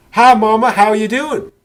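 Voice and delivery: put-on high, loud country-girl accent greeting her mother; imitating the heroine's broad country accent on the phone. Heroine phones her mother at home, loud country accent. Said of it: "she's totally suppressing that accent".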